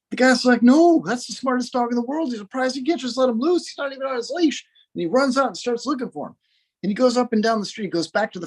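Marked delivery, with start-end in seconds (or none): 0.6-4.6 higher, mildly frantic protest; light mock panic, pitch held up; panicked owner. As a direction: act out an owner's rising alarm. Owner panics at dog being sent off.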